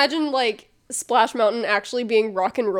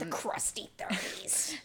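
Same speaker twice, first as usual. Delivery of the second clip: whispered; 4 semitones lower, 14 dB quieter than usual. Pretend. Joke phrase whispered.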